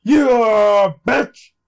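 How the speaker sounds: high, loud, broken-up taunt; triumphant taunt. Real, not acted. Player taunts the game after escaping, triumphant taunt. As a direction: punch out each word in triumph.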